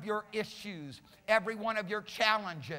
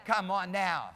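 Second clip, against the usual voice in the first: usual speaking voice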